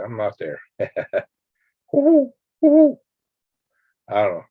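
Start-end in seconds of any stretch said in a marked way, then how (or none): 1.9-3.0 high falsetto hoots, loud; amateur hoot owl imitation; practice owl hoot. Shown as a demonstration. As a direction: have another go at an owl call. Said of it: "I gotta practice that".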